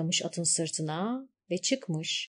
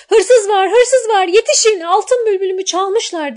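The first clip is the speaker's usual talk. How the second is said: high, loud panicked cry of thief; shouting for help; 11 semitones higher, 10 dB louder than usual. A narrator's reading. Girl alone in palace yelling for guards, high loud alarm. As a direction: raise the alarm at full voice. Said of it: "diye bağırmış".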